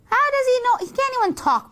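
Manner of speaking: high, incredulous objection; indignant protest. Acted. Daughter defending herself to Mommy, indignant. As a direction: shoot up high in disbelief.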